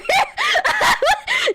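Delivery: high screaming cackle; shrieking laugh. Real, not acted. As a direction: burst into a shrill laugh.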